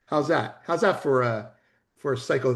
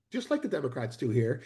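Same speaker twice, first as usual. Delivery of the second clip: ordinary talk, nothing marked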